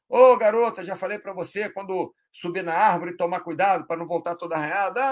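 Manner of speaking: fed-up nagging; mock scolding parent. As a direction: tell her off sharply. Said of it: "forma mais agressiva".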